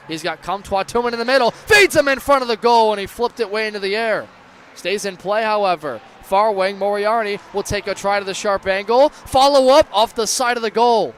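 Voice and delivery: building excitement near the net; excited play-by-play. Real, not acted. Scramble in front.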